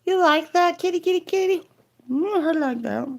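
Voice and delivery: high cooing pet voice. Real, not acted. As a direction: coo up high while petting. Petting and cooing to cat.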